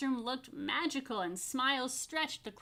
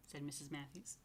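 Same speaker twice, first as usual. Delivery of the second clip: whisper; 5 semitones lower, 12 dB quieter than usual. A narrator's reading. Narration tag whispered.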